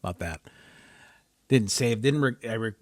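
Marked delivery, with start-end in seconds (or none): 0.0-0.4 sighing, hushed exasperation; low, deflated irritation after a sigh; mad. Real, not acted. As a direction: grumble softly, drained by annoyance. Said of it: "I'm so mad about that".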